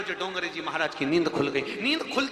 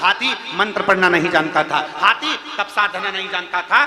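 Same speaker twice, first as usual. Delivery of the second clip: loud, driving sermon cadence; fervent preaching; 6 semitones higher, 8 dB louder than usual. Acted. Preacher rouses the listeners about the elephant, loud and fervent. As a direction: hammer the point home to the congregation.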